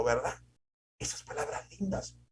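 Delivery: ordinary talk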